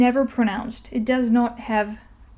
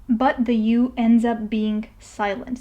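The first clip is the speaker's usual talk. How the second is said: ordinary talk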